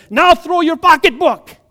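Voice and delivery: high, loud pulpit shout; fired-up pastor. Acted. Pastor urging the fired-up woman, shouting. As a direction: belt out the command. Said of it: "sees this woman so fired up for Jesus".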